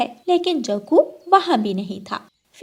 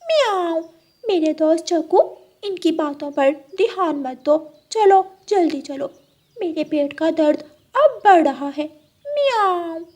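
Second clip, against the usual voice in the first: high, sweet-talking cat voice with meows; a scheming cat luring her friend; high purring cat voice; 6 semitones higher, 3 dB louder than usual. Acted. Cat hurries crow.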